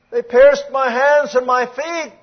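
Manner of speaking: loud, high, slow scripture cry; ringing, drawn-out lament; anguished cry. Acted. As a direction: cry it out to the congregation. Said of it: "Oh, what a cry".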